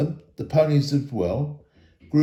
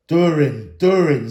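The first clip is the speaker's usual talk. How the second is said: louder, ringing call of the name; ringing repetition; 6 dB louder than usual. Acted. Thorin echoes his ancestor's name, louder and stirred.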